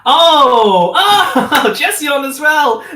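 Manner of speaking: high, laughing exclamations; laughing surprise. Real, not acted. GM laughing as Jess the cat yawns, high laughter.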